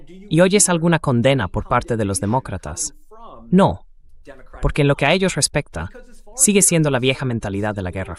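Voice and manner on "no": a sharp, higher, louder No; emphatic no. Real, not acted. Answering the viewers with a firm No. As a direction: answer your own question with a firm No. Rhetorical answer to own question.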